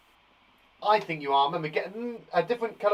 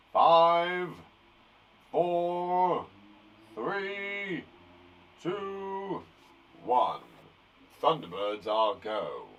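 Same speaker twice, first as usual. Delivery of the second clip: Thunderbirds countdown; much slower than usual. Retold or imitated. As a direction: count down like mission control. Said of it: "I used to love that program".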